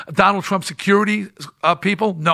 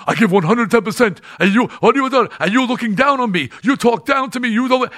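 higher, louder, indignant ranting; hammed-up wounded rage, voice climbing; angry outburst; 6 semitones higher, 3 dB louder than usual. Lou blowing up at Penn in the boardroom, loud angry rant.

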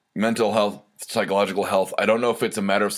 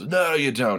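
higher, mock-stubborn comeback; retold defiant contradiction; 6 semitones higher than usual. Drunk friend disputing that he was recorded, mock defiant.

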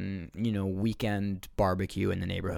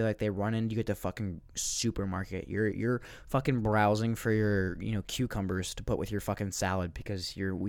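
ordinary delivery

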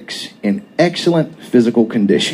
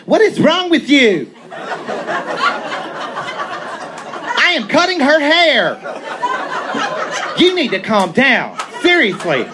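hammed-up high camp lilt, much higher; exaggerated camp voice; 13 semitones higher than usual. Mock camp voice to calm the jealous boyfriend. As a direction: play the flamboyant hairdresser, laughing through it.

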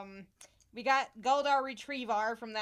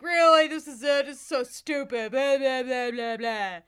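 scornful dudebro; 2 semitones higher, 9 dB louder than usual. Retold or imitated. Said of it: "people mostly cis dudes were like".

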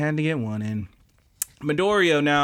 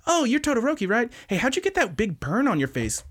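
high, cutesy airhead voice; distracted, curious girl; 10 semitones higher than usual. Hado jumps in front of Shoji, high curious chirp. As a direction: imitate a girl firing off curious questions. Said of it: "and then she goes".

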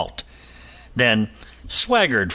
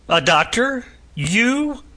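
mocking, rising much higher on You; scornful disbelief; 13 semitones higher, slower than usual. A narrator's reading. Captain scoffs at the prisoner claiming to be a doctor. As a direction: scoff at the very idea. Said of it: "Scorn of that lie".

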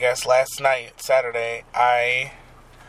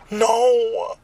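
dismayed cry; 9 semitones higher than usual. Real, not acted. Podcaster finding the wanted clip deleted, dismayed cry.

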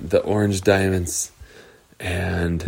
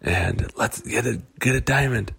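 whispered, sneaky plan; secret whisper; 5 semitones higher, 5 dB quieter than usual. Acted. Wendy whispers a sneaky plan to Coco. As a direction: hush it to a friend. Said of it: "Wendy leaned over to Coco and said".

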